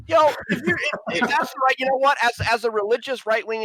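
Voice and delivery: sudden high, loud cut-in. Real, not acted. Debater raises his voice to push back on the South. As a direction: jump in loud and high to grab the floor.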